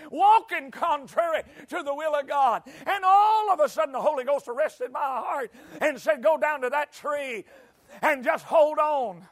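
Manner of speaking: high, loud shouted sermon testimony; full-force preaching. Acted. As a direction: preach it like a revival climax.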